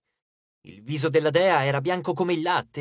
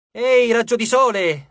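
raised, loud call for attention; exasperated outburst; 5 semitones higher, 7 dB louder than usual. A narrator's reading. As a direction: burst out to get noticed. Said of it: "sbottò Leo". (sbotto esasperato)

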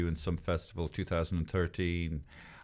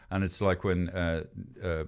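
ordinary talk

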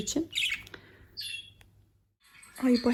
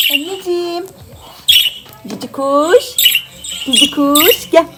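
higher, louder coaxing call to a bird; coaxing pet talk; 7 semitones higher, 8 dB louder than usual. Acted. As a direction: coax the bird to fly over. Calling the bird to come. (kuşunu çağıran tatlı ses)

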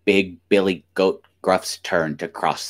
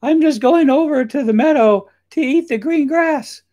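very high, loud tiny goat; loud, squeaky goat voice; little billy goat; 8 dB louder than usual. A narrator's reading. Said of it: "Little Billy Goat Gruff".

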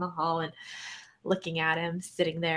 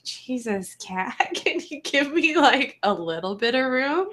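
laughing complaint; 6 semitones higher, 4 dB louder than usual. Retold or imitated.

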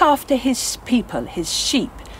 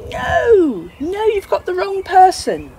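emphatic denial; 8 semitones higher than usual. Acted. Peter's third denial.

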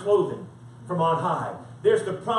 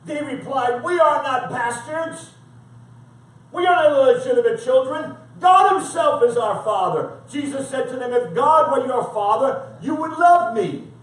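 emphatic scripture reading, pitched up; forceful preaching; 4 semitones higher, 7 dB louder than usual. Acted. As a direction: preach it as a firm rebuke.

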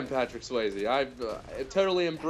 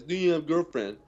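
a light mock deaf-girl voice; loosely imitating a deaf woman's speech; deaf voice impression; 3 semitones higher than usual. S0 repeats the girl's question, lightly voiced deaf girl. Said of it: "That's my deaf impression".

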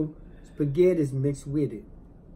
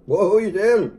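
indignant; 4 semitones higher, 7 dB louder than usual. Real, not acted. Mock-indignant retort.

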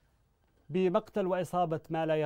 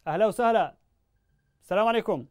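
calling louder over a bad phone line; raised voice; 5 semitones higher, 6 dB louder, faster than usual. Real, not acted. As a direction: speak up so the caller hears. Caller can't hear, bad line.

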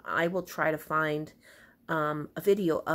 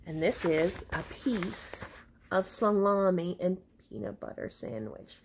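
usual speaking voice

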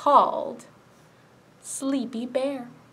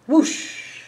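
breathy whoosh of feathers; whooshing sound; 3 semitones higher, 5 dB louder than usual. Acted. Storyteller making feathers whoosh for children. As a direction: blow it out like wind.

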